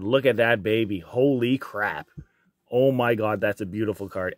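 usual speaking voice

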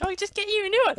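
very high-pitched mocking squeak; mock falsetto, dismissive of the loss; mocked girlfriend. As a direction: imitate a girlfriend in a silly high voice. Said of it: "She's like".

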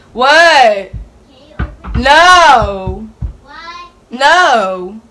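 loud shocked what, no; loud mock disbelief. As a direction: act stunned, loud. Mock disbelief, acting stunned.

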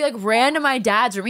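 usual speaking voice

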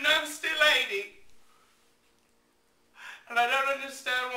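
hurt angry outburst. Acted. Upset rant at rude lady.

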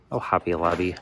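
ordinary talk

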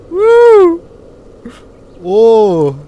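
loud, high drawn-out whoops; real high-pitched thrill; thrilled whooping. Real, not acted. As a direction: whoop out loud on a wild ride.

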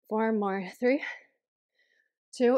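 usual speaking voice

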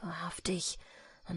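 soft whisper. A narrator's reading. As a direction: breathe it under your breath. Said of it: "flüsterte der Jäger".

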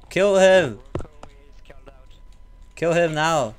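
loud urgent command; shouting. Real, not acted. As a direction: yell at them to shoot. Urging team to attack.

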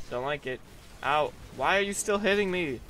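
ordinary delivery

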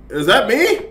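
high, sharp alarmed question, ME rising. Real, not acted. Gamer spotting something scary, alarmed question. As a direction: ask "Is that me?" with a startled jump up in pitch.

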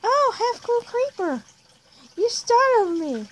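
very high, drawn-out mock surprise; hammed-up startled parent, slow and squealed. Parent caught off guard by child, high startled voice.

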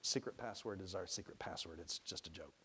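put-on soft, low aside to a child; hushed, confiding explanation; explaining aside. A narrator's reading. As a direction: drop the voice to explain privately. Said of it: "To Ty, he explained".

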